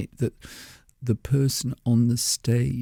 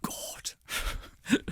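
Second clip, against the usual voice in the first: unvoiced whisper under the breath; whispered thought; 8 dB quieter than usual. Whispering his dismay at the awkward studio pause. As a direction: breathe it out as a private groan.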